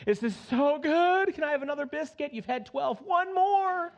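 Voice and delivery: high, greedy glutton at the table; himself at Thanksgiving. Pretend. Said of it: "I'm gonna be like".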